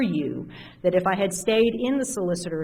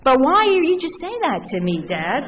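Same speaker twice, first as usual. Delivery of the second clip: put-on kid voice; 10 semitones higher, 3 dB louder than usual.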